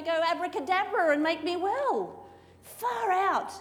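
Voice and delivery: high, outraged huffing; indignant complaint. Acted. Naaman scoffing at dipping in Israel's river, high and outraged. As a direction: scoff at the little river, pitch shooting up.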